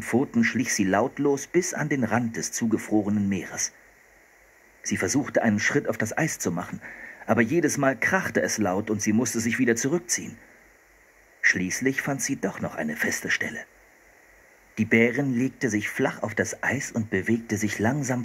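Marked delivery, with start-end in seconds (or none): none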